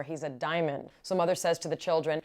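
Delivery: ordinary delivery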